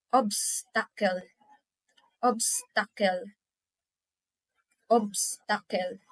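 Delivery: "obstacle" split as obs, pause, tacle; syllable by syllable. Shown as a demonstration. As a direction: break "obstacle" after the first syllable. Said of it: "I'm pronouncing it by syllables".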